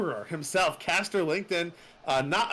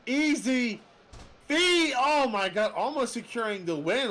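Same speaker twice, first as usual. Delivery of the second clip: excited; 5 semitones higher than usual. Real, not acted. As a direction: build from excited to a near-shout. Commentator reacting to big move.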